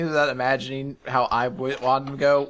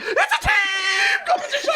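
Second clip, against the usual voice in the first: crazy king's yell. Acted. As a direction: screech it like a mad king popping out. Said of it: "the King acts really crazy about".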